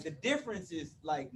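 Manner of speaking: ordinary talk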